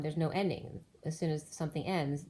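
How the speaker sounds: ordinary talk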